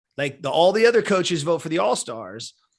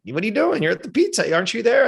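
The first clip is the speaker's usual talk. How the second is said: higher-pitched imitation of an incredulous mother; puzzled mom; 9 semitones higher, 3 dB louder than usual. Mom questioning her kid's call from the pizza party, puzzled. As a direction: quote mom's bewildered questions, pitched up.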